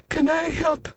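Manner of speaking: small and polite from down below; tiny voice. Acted. Tiny Mister Small introduces himself, high and soft.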